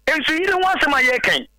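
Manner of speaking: ordinary delivery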